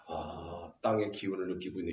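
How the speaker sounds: low, hushed mock dignity; two-faced scholar. Scholar who was begging now faces onlookers, feigning composure. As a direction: play it cool, softly and low. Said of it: "좀 아까까지 호랑이한테 빌던 놈이".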